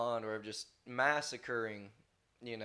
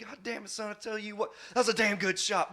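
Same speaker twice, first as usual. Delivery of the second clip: higher, rough country uncle chewing out his son; scolding uncle; 9 semitones higher than usual. Retold or imitated. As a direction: scold the boy but sound secretly proud. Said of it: "He's like".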